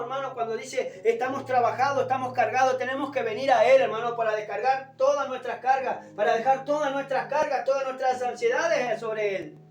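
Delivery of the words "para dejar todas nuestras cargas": ordinary delivery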